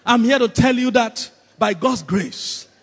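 loud, high mock-solemn address; hammed-up heroic farewell speech; grand speechmaker. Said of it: "You say, Ladies and gentlemen".

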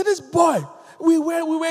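high broken mock lament; voicing a grieving friend. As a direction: stammer like a heartbroken friend. Pastor voices grieving friend.

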